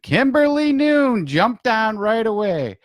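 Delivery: high, loud, drawn-out welcome; excited shout-out. Real, not acted. Host greets Kimberly joining the livestream, excited shout-out. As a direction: call out the arrival with stretched, elated words.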